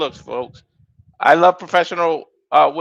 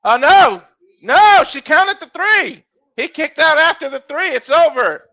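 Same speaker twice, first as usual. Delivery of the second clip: higher-pitched, worked-up call of the finish; excited disbelief; 11 semitones higher, 5 dB louder than usual. Real, not acted. Commentator reacting to the disputed three count, excited disbelief. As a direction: react fast to the botched count.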